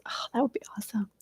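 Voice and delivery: soft hopeful whisper; whispered aside. Real, not acted. Aside to the viewers about her test, whispered. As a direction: breathe it out like a secret wish.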